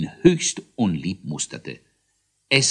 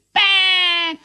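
very high, loud bleat; defiant hare; 8 dB louder than usual. A narrator's reading. Hare sticks out his tongue at the judge, jeering. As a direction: jeer with the tongue stuck out.

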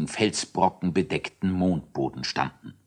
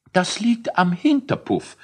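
high scientist voice; 11 semitones higher than usual. Acted. Scientist Elisius explains.

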